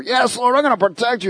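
high, boastful promise; boastful Peter. Acted. Peter boasting to Jesus, high boastful voice. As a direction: brag to the Lord with the voice lifted high. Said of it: "Peter was all boastful".

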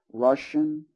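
ordinary delivery

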